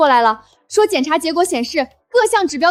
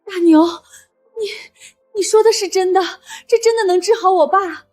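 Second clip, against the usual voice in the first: tearful, halting disbelief; falter, overcome with hope; moved to tears. Acted. Hearing her father can be cured, tearfully moved.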